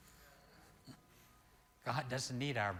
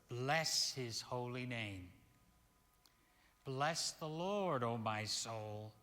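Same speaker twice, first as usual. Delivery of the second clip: slow, soft solemn psalm reading; scripture reading; 2 semitones lower, 5 dB quieter, slower than usual. Acted. Minister reading a psalm slowly and softly. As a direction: read the psalm slowly to the congregation.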